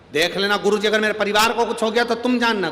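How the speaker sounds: a retold threat, said fast and loud; threatening warning. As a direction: warn him hotly, rushing the words. Man warns guru about his family.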